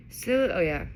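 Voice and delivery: plain reading voice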